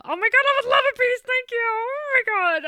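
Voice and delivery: high, loud rapture; party guest gushing over dessert; gushing delight. Acted. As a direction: swoon over the cake. Gushing over lava cake.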